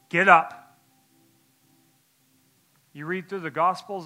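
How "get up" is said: sudden loud command, Get up; commanding call. Retold or imitated. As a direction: bark it out like an order. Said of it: "and he says".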